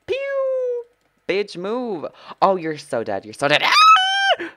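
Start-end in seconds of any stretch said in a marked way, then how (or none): 0.0-0.8 very high drawn-out "pew"; high falling zap noise; imitated shot noise. Real, not acted. Imitates shot while attacking in game. 3.6-4.5 very high, loud shriek; long high-pitched yell; sudden odd scream. Real, not acted. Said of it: "I don't know what that was".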